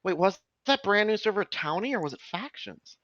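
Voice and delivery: usual speaking voice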